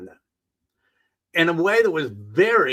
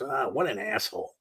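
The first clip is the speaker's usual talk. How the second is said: dismissive aside; 4 semitones lower, 7 dB quieter than usual. Retold or imitated. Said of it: "that's the way I always".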